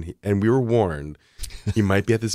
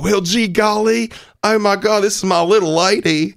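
mock Southern accent; 10 semitones higher, 3 dB louder than usual. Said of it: "No accent".

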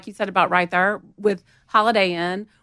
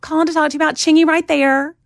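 put-on Southern accent; 10 semitones higher, 4 dB louder than usual. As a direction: sing out a Southern hello on a work call. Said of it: "I had it in my Southern accent".

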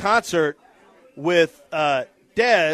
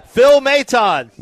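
slow, stretched emcee call; raised voice, names drawn out; 3 semitones higher, 4 dB louder, much slower than usual. Real, not acted. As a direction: announce each player to the crowd. Calling names for crowd applause.

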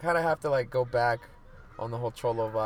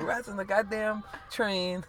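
put-on slow, high [name] accent, each word dragged out; imitating [name]; 9 semitones higher, slower than usual. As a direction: stretch it out like [name] complaining. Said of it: "the way in her accent and shit".

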